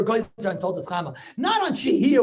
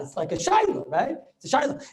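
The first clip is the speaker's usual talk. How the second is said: ordinary delivery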